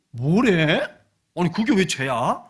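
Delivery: loud incredulous retort; acted scoffing disbelief. Unbeliever objects to coveting being sin, acted scoffing disbelief. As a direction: scoff back in protest.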